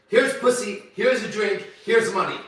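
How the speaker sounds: high, loud, punched-out list; acted loud offers. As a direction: call out each offer separately. Acted offers while coaching the listener.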